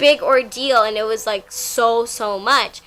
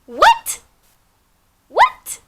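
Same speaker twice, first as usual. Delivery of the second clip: squeaky, high-pitched double take; stunned disbelief; 7 dB louder than usual. Retold or imitated. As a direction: shriek it in total disbelief, way up high. Shocked reaction to his message.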